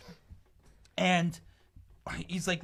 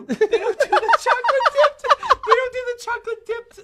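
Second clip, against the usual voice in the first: high and louder, laughing through the words; laughing quote; 10 dB louder than usual. Retold or imitated. Imitating friend's ice cream insistence, high laughing. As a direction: repeat her line, cracking up. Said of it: "She's like".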